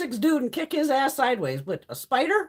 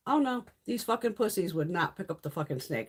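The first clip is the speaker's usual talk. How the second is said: usual speaking voice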